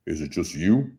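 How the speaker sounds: a hint of a low, gruff Stallone; low-key tough-guy question; lightly voiced Stallone. Host imitating Stallone asking if he's alone.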